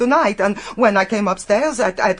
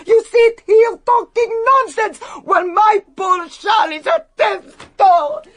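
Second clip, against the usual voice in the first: much higher, louder crying outburst; tearful furious shout; 11 semitones higher, 5 dB louder than usual. Acted. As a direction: break into sobbing rage. Housekeeper's outburst over Charles.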